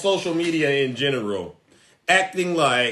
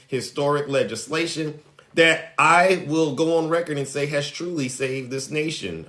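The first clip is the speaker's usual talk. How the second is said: normal talking voice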